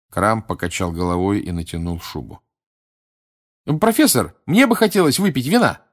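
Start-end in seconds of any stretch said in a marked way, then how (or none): 3.7-5.8 high-pitched eager pupil; high, wheedling student request. A narrator's reading. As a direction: pipe up keenly, high and hopeful. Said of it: "разохотился другой ученик".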